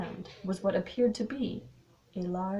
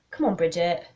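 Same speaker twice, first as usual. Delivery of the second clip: louder, coaxing call; mildly raised calling voice; 8 dB louder than usual. A narrator's reading. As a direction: call to someone a little way off. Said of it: "he called".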